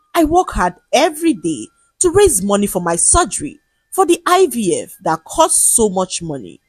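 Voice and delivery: ordinary delivery, nothing marked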